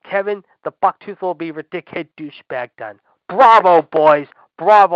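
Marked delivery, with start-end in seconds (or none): 3.3-4.9 loud, mocking slow clap of a word; bitter sarcastic congratulations; sarcastic bravo. Real, not acted. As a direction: sneer the praise at them. Sarcastic applause at WWE.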